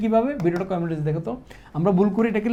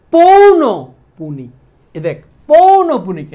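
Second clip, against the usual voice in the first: much louder and higher, slow drawn-out পৌন পুনিক; teacher calling out a hard spelling to the class; emphatic dictation; 6 semitones higher, 12 dB louder, slower than usual. Shown as a demonstration. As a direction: call out each syllable loud for the whole class. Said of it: "এটা লেখ এভাবে".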